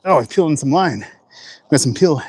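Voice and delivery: ordinary delivery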